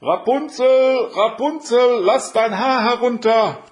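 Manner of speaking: high, drawn-out full shout; long-held high calling; very loud call. A narrator's reading. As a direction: shout up to a tower window, stretching each name. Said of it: "und rief ganz laut".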